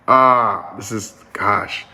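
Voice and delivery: choked, slowed and hushed with real emotion; getting emotional. Real, not acted. Speaker moved while telling restaurant workers' words, hushed and choking. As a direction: voice catching as it hits him mid-talk. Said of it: "I'm getting emotional on this".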